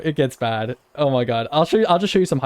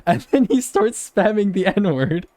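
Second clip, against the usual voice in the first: laughing in disbelief, higher and slower; 5 semitones higher, slower than usual. Real, not acted. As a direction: laugh through the words. Laughing while telling it.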